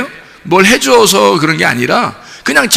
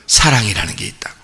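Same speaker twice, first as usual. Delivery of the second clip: hushed, lower whisper; 11 semitones lower, 6 dB quieter than usual. Real, not acted. Preacher telling the congregation what Jesus holds, in a whisper. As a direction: let it fall to a whisper.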